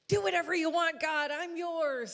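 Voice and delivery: high, loud, gushing surrender; a rush of eager devotion, slowing at the end; excited outburst. Retold or imitated. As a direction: blurt it out, carried away with excitement. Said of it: "And in our excitement".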